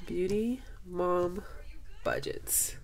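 slow, then whispered "Budgets"; awed whisper. Real, not acted. Rereading the name, awed.

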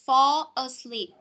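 "fall asleep" said slowly, stressing fall; slow model. Shown as a demonstration. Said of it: "Third word". (đọc chậm "fall asleep")

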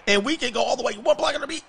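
excited rising call. Real, not acted. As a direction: climb up high and loud, hoping he breaks it. Kick returner breaking free.

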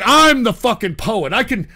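ordinary talk

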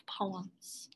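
a hushed storyteller's whisper; whispered word. A narrator's reading. Narrator whispering to young listeners, softly hushed.

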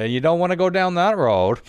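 movie quote voice. Acted. Quoting a trucker movie line.

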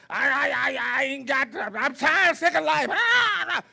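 a lightly voiced worn-out griper, ending in a groan; mock whiny complainer. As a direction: whine it like someone sick of everything, then sigh out. Mimicking a tired, snapping churchgoer.

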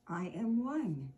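lively, expressive storybook read-aloud; animated picture-book reading for young listeners; reading to children. Acted. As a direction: read it like story time for little kids.

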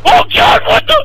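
very high, loud startled cry; shocked shriek. Real, not acted. Player shrieking at a sudden in-game surprise, shocked shriek. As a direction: shriek as if something jumped at you.

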